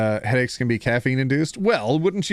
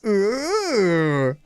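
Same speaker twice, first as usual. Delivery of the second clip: teasing ooh; 3 semitones higher, much slower than usual. Real, not acted. Gossiping about cheating boyfriend.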